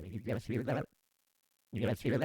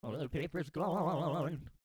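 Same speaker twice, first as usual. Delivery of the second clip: robotic warble; warbling vocoder voice. Acted. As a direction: speak through a warbling effect.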